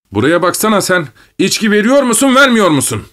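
high-pitched angry demand. A narrator's reading. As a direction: raise your voice, climbing sharply in pitch. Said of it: "dedi sesini yükselterek".

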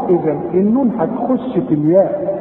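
normal talking voice

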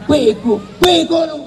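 high-pitched mock scolding yell; angry shout. Shown as a demonstration. Demonstrating how angry people yell up close, high shout. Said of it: "maka walaupun deket teriak".

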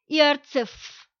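Иарцеф read as a foreign mangled surname; Иарцеф said stiffly, letter by letter, like the sign reads. Retold or imitated. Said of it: "Что-то типа". (исковерканная фамилия Иарцеф)

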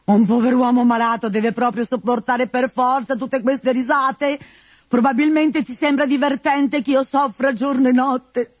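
put-on high, self-pitying old man's whine; sick old father complaining about the laughter, whiny and high; whining old Pantalone. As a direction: moan about your suffering.

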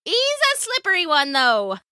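high, swooping sailor's voice; salty old hand, amused; wry pirate woman. A narrator's reading. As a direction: joke about the catch in pirate tones.

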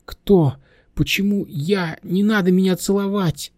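put-on high-pitched flustered girl; high, startled objection; alarmed protest. Girl wakes, protests a kiss.

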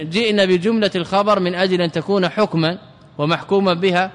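ordinary talk, nothing marked

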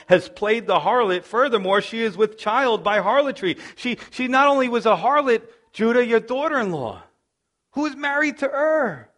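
high, loud preacher's build; impassioned sermon delivery; full-force preaching. Acted. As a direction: preach it hard to the congregation.

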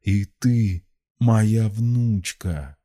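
put-on slow, anguished old man; pained reproach. A narrator's reading. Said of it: "с болью выдохнул старец".

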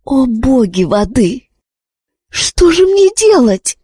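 high, frantic inner cry; frantic thoughts. A narrator's reading. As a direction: panic rising in her head. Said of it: "лихорадочно думала Виш".

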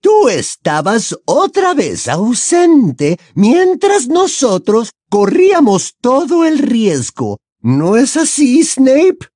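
high, loud, scornful villainess; high-pitched bitter challenge; Bellatrix, accusing. A narrator's reading. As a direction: press the accusation in a high female voice.

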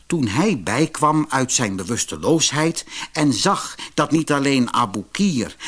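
usual speaking voice

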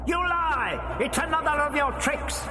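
very high, ringing outburst; furious accusation. A narrator's reading. Disembodied voice accusing the doctor of tricks, furious. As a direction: accuse on a high, held shriek.

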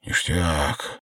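satisfied exhale of a word; understated relief; relieved. A narrator's reading. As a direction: say it with relief after a long drink. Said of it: "с облегчением произнёс".

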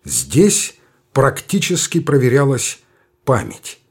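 usual speaking voice

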